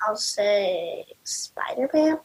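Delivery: whispering. Real, not acted. Child shyly whispers his answer.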